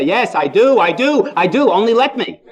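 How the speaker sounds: can't wait to say yes; eager I do. Pretend. Eager groom at the altar.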